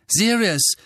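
sharp warning cry of a name; loud call. A narrator's reading. Harry calling out to stop Sirius, loud and high. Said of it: "sagte Harry laut".

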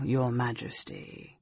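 slow, soft, mock-deferential title; silky, sarcastic courtesy; fine sarcasm. A narrator's reading. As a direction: linger quietly on the title with sarcasm. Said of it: "said the title with fine sarcasm".

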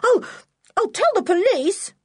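very high, spluttering threat; furious yell. A narrator's reading. George threatening Block with the police, in a fury. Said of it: "yelled the little girl in a fury".

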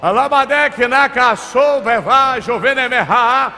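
rapid rhythmic tongues prayer, slowing at the end; speaking in tongues. Real, not acted. Pastor praying in tongues over the congregation, fervent. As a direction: pour out syllables in fervent prayer.

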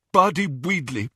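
talking through blood; thick bloodied speech; mouth full of blood. Acted. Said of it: "his mouth was full of blood".

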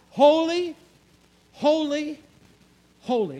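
loud rising Holy, then holy, holy falling away; ringing holy. Shown as a demonstration. Preacher proclaims the angels' song to his congregation, loud and ringing. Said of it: "When they repeated something three times".

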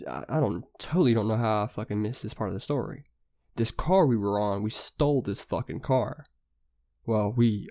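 ordinary talk

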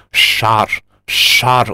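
«шар» with puffed cheeks; cheek lisp. Shown as a demonstration. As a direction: blow air into cheeks on «шар». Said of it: "щёки надуваются".

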